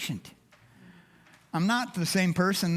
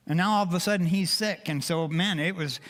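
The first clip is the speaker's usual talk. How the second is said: usual speaking voice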